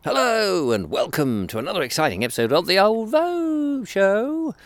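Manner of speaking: high, zany show-host announcer; over-the-top wacky intro voice; wacky radio host. Acted. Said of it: "full-on madness".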